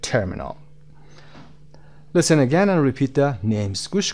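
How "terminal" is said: "Terminal" with a strong first syllable, pitched low; lower-pitched "Terminal", weight on "Ter"; stress on TER. Shown as a demonstration.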